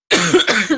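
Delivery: sharp cough. Real, not acted. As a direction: cough out the bug. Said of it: "maybe I just need to see you again".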